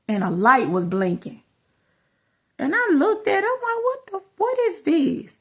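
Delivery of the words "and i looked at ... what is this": very high-pitched, puzzled disbelief; retold bafflement. Mother finds a blinking light in her picture, high baffled reenactment. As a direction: act out finding something strange, voice shooting up high.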